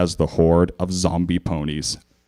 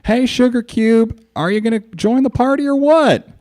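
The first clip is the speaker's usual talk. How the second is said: Applejack shouting; 4 dB louder than usual. A narrator's reading. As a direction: call over the crowd. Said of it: "shouted over the noise".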